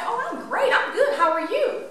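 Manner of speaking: mock-chirpy, high-pitched polite reply; put-on cheery. Answering how we're doing while hiding troubles, put-on cheery. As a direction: lift it high in a fake everything's-great voice.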